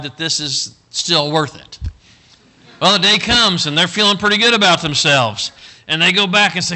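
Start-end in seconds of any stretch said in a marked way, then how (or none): 2.8-6.8 loud, high-pitched preaching; full-force storytelling. Acted. Preacher launching the boys' next scheme, full force. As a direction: belt out the next turn of the tale.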